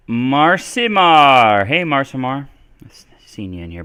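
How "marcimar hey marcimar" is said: name drawn out loud and high; calling out a greeting. Real, not acted. Streamer greeting a returning viewer, drawn-out call. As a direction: hail a returning viewer with a long stretched name.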